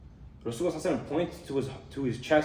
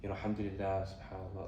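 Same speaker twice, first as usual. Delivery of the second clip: mock-pious murmur, much softer and lower, fading; 5 semitones lower, 10 dB quieter than usual. Showing outward taqwa to the masjid crowd, mock hushed piety. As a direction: parody a show-off's hushed holy talk. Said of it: "talking like that".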